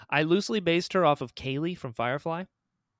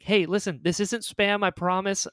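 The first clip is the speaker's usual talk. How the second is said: retold urgent plea; 6 semitones higher, 4 dB louder than usual. Re-enacting urgent message to stranger.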